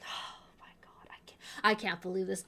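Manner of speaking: fast, breathless whisper of disbelief; stunned whisper. Real, not acted. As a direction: whisper it, floored. Stunned after the reveal.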